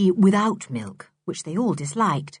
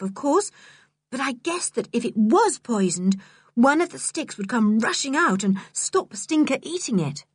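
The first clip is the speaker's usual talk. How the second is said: put-on higher-pitched, earnest child character; higher voice, anxious reasoning; worried George; 4 semitones higher than usual. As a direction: explain urgently in a raised child's voice. Narrator voices George explaining.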